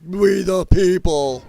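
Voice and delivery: slow, drawn-out mocking chant; mock screaming. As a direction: sneer the slogan back at them.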